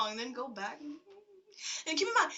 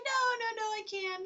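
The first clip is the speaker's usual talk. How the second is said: high, protesting retold refusal; flustered refusal; 10 semitones higher, 4 dB louder, faster than usual. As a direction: wave the offer away in a high voice. She retells refusing the offer.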